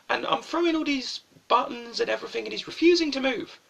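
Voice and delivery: ordinary talk, nothing marked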